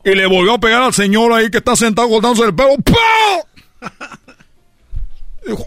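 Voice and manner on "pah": high shouted pah; mock punch noise; punch sound. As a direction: smack like a blow. Imitated punch.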